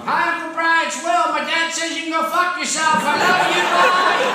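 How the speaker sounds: put-on high, loud little boy voice; kid's phone message. Son leaving uncle a phone message, high child voice. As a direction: chirp it like an innocent child. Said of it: "I make him leave messages".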